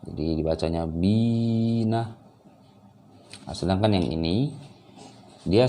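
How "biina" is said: "bina" with the i held long on a raised, chanted pitch; long vowel. Shown as a demonstration. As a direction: stretch the "bi" of "bina" well out, then a short "na". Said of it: "dia harus dibaca panjang". ("bina" dibaca panjang)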